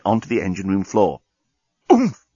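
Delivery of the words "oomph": short, high grunt on landing; pained grunt. A narrator's reading. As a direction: grunt as if thrown to the floor. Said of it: "grunted the journalist".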